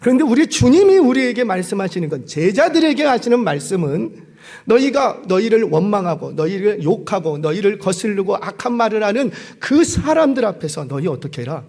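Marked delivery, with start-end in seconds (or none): none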